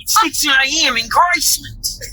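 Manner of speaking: slow attempt at an Australian accent that slides Germanic; a failed Australian accent that drifts toward German. Shown as a demonstration. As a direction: try an Aussie accent and lose it halfway. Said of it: "like, weirdly Germanic or something".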